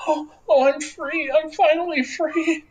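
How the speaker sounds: put-on high, tearful girl's voice, sobbing with relief; freed girl sobbing thanks to her rescuers, high and tearful; crying freed girl. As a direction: voice a young girl weeping with joy. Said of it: "The girl begins crying".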